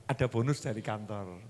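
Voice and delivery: soft, low offering; gentle husband. Acted. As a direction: offer the gift kindly. Husband offers bonus softly.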